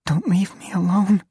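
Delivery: high, pleading and desperate. Acted. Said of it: "her voice desperate".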